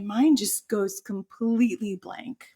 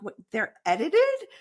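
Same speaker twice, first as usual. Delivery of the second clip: higher, incredulous what; shocked; 7 semitones higher than usual. Retold or imitated. Speaker hearing TED edits talks, higher stunned question. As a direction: blurt it out in disbelief. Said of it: "I'm like".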